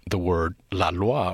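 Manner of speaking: la loi said in French; la loi pronounced as in French. Shown as a demonstration. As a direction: give la loi a French sound. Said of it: "a French reader would hear in the name Malloy".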